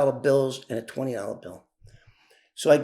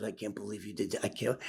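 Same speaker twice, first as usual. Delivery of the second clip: much softer, lower, fast; awed murmur; 7 semitones lower, 13 dB quieter, faster than usual. Retold or imitated. Joey unloading the truck, murmuring in disbelief. Joey stunned, moving stuff.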